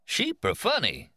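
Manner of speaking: put-on high, slow kindly old Kansan; folksy elderly teacher with a Kansas drawl; old man, Kansas accent. A narrator's reading.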